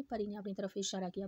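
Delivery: ordinary delivery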